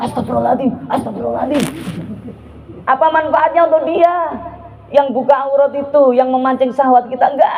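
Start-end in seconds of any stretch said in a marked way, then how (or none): 0.1-1.6 mock pious murmur, softer; hammed-up holier-than-thou sigh; showy piety. Preacher mocking the show-off believer, murmured. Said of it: "Jangan sok-sokan".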